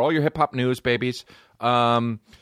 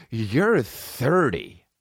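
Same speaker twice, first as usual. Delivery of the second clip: slow incredulous; 2 semitones higher, much slower than usual. Real, not acted. Mocking slow disbelief.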